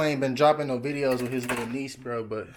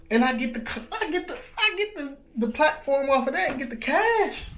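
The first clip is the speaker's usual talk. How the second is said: high-pitched, hyped-up rush of hope; excited daydreaming; 13 semitones higher than usual. Real, not acted. Vlogger dreaming of cash from his hair platform, excited and high. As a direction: get carried away, voice climbing high. Dreaming aloud of making it big.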